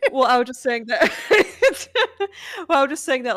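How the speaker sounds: loud, high, giggly restart; laughing. Real, not acted. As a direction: try to talk through your laughter. Laughing after talking over each other.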